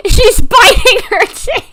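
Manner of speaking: high, loud squeal of laughter at the cat; delighted laughing cry. Real, not acted. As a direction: burst out laughing as you say it. Laughing at cat biting tail.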